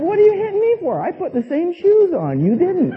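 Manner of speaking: high-pitched, squealed mock outrage; mock indignant protest. Brother retorting to sister who hit him, mock indignant.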